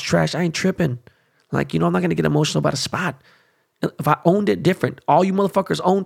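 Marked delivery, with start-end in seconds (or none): none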